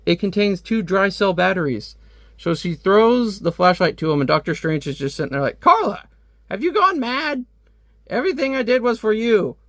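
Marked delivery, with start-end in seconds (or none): 5.6-9.5 much higher, stunned villain crying out to his daughter; a shocked father hearing his own daughter turned on him. Retold or imitated. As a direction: voice shoots up in betrayed disbelief. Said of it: "he's really shocked".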